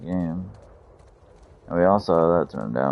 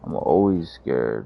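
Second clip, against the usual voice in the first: ordinary delivery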